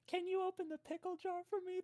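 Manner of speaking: put-on high, soft, girlish plea; high-pitched little favor-asking voice; sweet helpless request. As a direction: play helpless to get his help. Asking a man for help.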